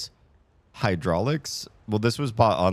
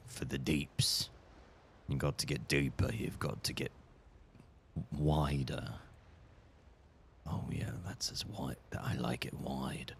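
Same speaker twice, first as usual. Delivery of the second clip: low mutter; 5 semitones lower, 11 dB quieter than usual. Real, not acted. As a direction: mumble under your breath. Low muttering while resizing window.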